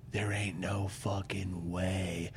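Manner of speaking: low, slow, mock stubborn mutter; muttered refusal. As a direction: drag out a grim refusal under the breath. Refusing to get in water.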